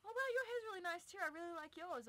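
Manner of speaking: very high, quick mock gushing; over-polite, hammed-up flattery; gushing compliment. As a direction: gush in a put-on high voice. Acting out a polite compliment back.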